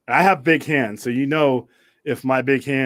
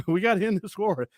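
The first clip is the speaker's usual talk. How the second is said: higher-pitched imitation of a film argument; retold arguing; 9 semitones higher than usual. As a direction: quote a frustrated adviser pleading his case. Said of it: "when he was arguing about Sonny".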